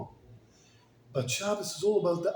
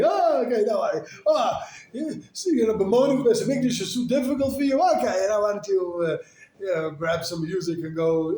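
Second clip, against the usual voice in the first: mock dismissive voice; 4 semitones higher, 6 dB louder than usual. Mock imagined excuse voice.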